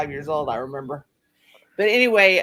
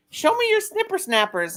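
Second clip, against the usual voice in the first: put-on high, eager toddler voice; little boy voice; 10 semitones higher than usual. Mother voicing her toddler son, high childlike. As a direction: coax like a curious small child. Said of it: "And he said".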